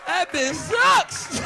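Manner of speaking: loud, high, very slow holler; stretched shout. Acted. Comic hammering the punchline home to the crowd, drawn-out high yell. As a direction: drag every word out in a yell.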